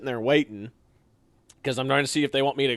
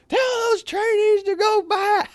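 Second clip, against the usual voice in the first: much higher, drawn-out shouted order; 4 dB louder, slower than usual. Retold or imitated. Drill sergeants in trucks shouting orders at the two trainees.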